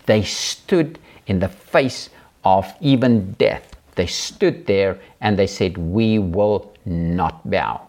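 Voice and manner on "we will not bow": very slow, each word weighted; slow firm declaration. Acted. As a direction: land it slowly and resolutely. Climax of the story.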